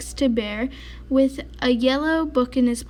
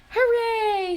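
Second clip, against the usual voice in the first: high delighted hooray; cheer; 10 semitones higher than usual. Acted. Mouse cheering over the roller skates, high hooray. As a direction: cheer with glee.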